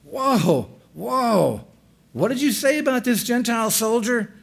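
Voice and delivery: high, incredulous whoa and questions; astonished disbelief. Pretend. Preacher voicing a shocked listener, incredulous and high. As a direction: gasp in disbelief, pitch up high. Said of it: "Read that like".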